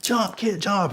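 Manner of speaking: urgent high plea. Retold or imitated. Urging the kid to jump.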